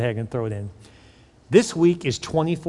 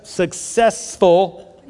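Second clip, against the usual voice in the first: "successful" drawn out very slowly; slow word; much slower than usual. Shown as a demonstration. Pastor correcting a stumbled word for the congregation, very slow. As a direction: stretch "successful" out syllable by syllable. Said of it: "say that word slowly".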